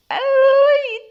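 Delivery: high, loud, sustained celebratory cry; celebratory ululation. Acted. Friend celebrates the proposal news, loud high ululation. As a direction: let out a long joyful zaghrouta.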